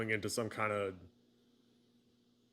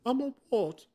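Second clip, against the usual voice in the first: high, loud put-on voice; startled film-quote reply; 6 dB louder than usual. Friend answers the Hagrid quote, mock-astonished young wizard. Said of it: "so Hagrid from Harry Potter".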